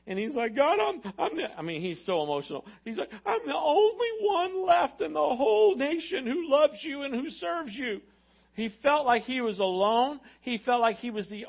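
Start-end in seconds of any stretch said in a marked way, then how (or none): 0.5-1.5 higher, louder plea to God; a desperate cry up to heaven; Elijah's despairing lament. Retold or imitated. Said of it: "He actually cries out to God". 3.2-8.0 high, slow, despairing plea; Elijah's slow anguished cry; crying out to God. Retold or imitated. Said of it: "He actually cries out to God".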